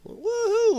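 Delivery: very high "woohoo" whoop; silly falsetto cheer; playful whoop. Pretend. Whooping while moving screen around.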